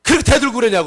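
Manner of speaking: high, loud opening burst; acted angry reprimand; furious husband. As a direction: tear into your wife for talking back. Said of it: "그러면서 그냥 손찌검을 확 한 겁니다".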